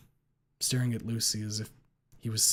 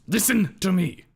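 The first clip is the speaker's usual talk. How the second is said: sharp shout, much higher and louder; raised voice; 8 semitones higher, 9 dB louder than usual. A narrator's reading. Ripley shouting at Lucy to make her listen. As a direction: bark it to cut through. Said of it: "He raises his voice".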